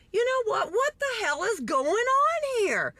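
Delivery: high-pitched, incredulous demand; exasperated outburst. Retold or imitated. Bystander confronts confusion, exasperated outburst. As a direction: burst out in baffled exasperation. Onlooker's exasperated reaction retold.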